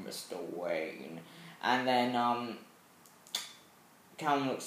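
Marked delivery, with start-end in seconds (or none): 0.1-1.2 very low, slow, hushed villain greeting; Bane. Acted. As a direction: draw it out deep and soft. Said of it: "and Bane's coming in".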